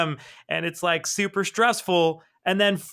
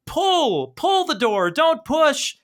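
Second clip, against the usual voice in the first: higher, insistent pull; urgent command; 8 semitones higher, much slower than usual. Retold or imitated. Jenny telling Zot to pull the door, urgent. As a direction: bark the fix at him.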